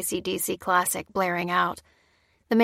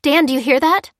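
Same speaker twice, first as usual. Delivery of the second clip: urgent; 7 semitones higher, 7 dB louder than usual. A narrator's reading. Waking him, urgent.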